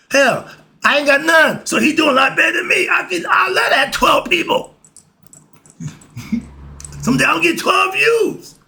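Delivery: laughing shout. Real, not acted. Joking aside about his friends.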